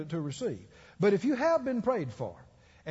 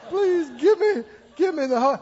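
high, loud wail to God; acted-out pleading; 8 semitones higher, 6 dB louder than usual. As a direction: cry out the plea in hammed-up desperation. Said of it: "and I'm still going".

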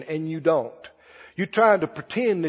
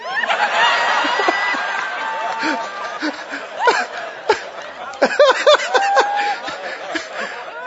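long high-pitched cackle; cackling laughter. Retold or imitated. Minister recalling his elder tickled by the honesty, cackling. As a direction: cackle on and on helplessly. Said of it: "Just cackle".

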